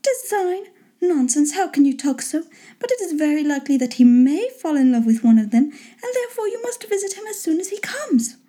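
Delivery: high-pitched chiding lady; put-on high, exasperated wife voice. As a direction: pitch it up and scold the husband lightly. Wife dismisses husband's question.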